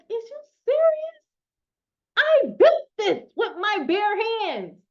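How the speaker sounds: high, disbelieving squeal; can you believe these people; mock incredulous cry, slow and loud. Incredulous retort to doubters.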